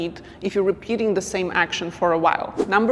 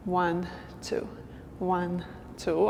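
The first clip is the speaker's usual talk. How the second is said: soft count in quicker pairs; double-time count; 8 dB quieter, slower than usual. Shown as a demonstration. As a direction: count one-two twice as fast, softly. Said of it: "go twice as fast".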